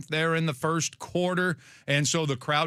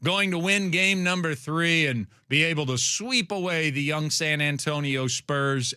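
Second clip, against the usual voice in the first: ordinary delivery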